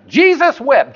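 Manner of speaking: high, quick playful Jesus wept; mock proud recital. Congregant proudly reciting the verse at home, high and playful. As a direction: rattle it off like a proud kid. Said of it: "and you say".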